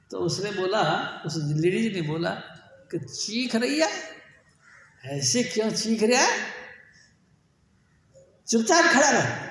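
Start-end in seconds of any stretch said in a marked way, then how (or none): 3.0-9.5 high, loud scolding woman's voice. Retold or imitated. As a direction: tell him off sharply to stand still. Woman scolds shouting Farid.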